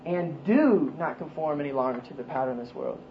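"do" stretched and lifted in pitch above the rest; stress on "do". Shown as a demonstration. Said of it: "make an emphasis on each word".

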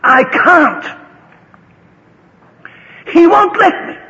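high, loud yell from a shell hole; shouted reply. Retold or imitated. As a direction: holler it back helplessly. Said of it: "shouting to him right out".

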